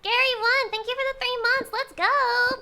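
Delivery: high, loud celebratory cheer; excited shout-out. Real, not acted. Streamer thanking a subscriber for three months, excitedly cheering.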